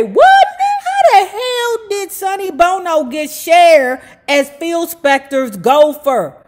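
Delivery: very high, loud incredulous squeal; mock-astonished "What?" and on; shocked disbelief. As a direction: play the stunned reader, outraged. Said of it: "And you say".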